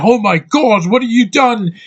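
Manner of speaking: high, alarmed yelp of a shocked friend; panicked cry. Retold or imitated. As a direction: act out panic as if a friend stabbed his eye. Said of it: "Simon Pegg's like".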